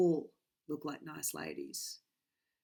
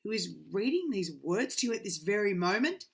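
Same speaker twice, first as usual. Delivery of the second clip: ordinary delivery, nothing marked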